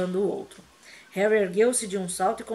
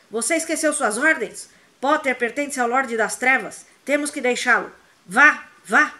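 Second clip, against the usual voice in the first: high, loud furious orders; angry shouting; 4 semitones higher, 6 dB louder than usual. A narrator's reading. Snape shouts at the Death Eaters to leave Harry, angry. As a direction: rage at them to obey. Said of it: "Snape gritava".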